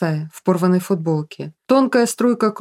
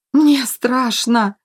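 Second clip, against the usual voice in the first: slow, higher, squeezed-out cry; frightened; 5 semitones higher, much slower than usual. A narrator's reading. Kirill sinks before the ghost, squeezed-out fearful cry. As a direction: force it out in fear. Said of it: "кое-как выдавил он".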